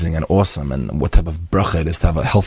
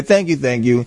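rote mock thanks; 6 semitones higher than usual. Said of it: "You know, say".

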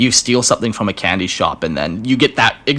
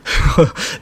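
short breathy laugh; 6 semitones higher than usual. Real, not acted. Listener laughs at ending.

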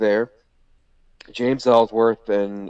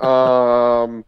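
long, loud stalling "um"; mock hesitation; 7 dB louder than usual. Trainee told to chop the wrestler, mock hesitation. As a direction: stall on one held sound, acting out reluctance.

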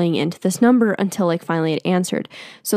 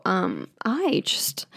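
whisper; 6 dB quieter, much slower than usual. Real, not acted. Whispered aside.

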